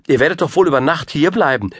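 high, hurried dismay; startled queen. A narrator's reading. Queen urging the wizard to stay, startled. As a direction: urge it high and quick, taken aback. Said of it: "sagte die Königin erschreckt".

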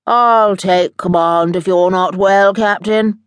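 a robot offering to take over. Acted. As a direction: Gizmo eyeing the captain's chair, robotic. Gizmo robot voice.

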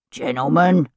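Cockney sergeant's sharp rebuke; menacing Cockney. A narrator's reading. Sergeant interrupts horseplaying lieutenants, menacing Cockney. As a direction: bring them up short in menacing Cockney. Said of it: "The menacing Cockney tones of Sergeant Cutter".

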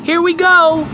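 "Here we go!" thrown out joyfully as the car starts; excited cry. Acted. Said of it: "Benny cried".

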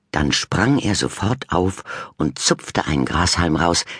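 plain reading voice

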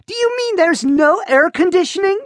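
put-on panicky teen. A narrator's reading. Daughter panicking.

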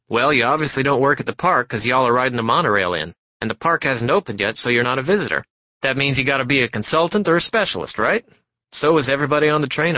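brash, loud, eager talker, words tumbling out; a pushy stranger who won't stop talking; loud chatty salesman. Acted. Passenger chatting up Warren on the monorail, loud and eager.

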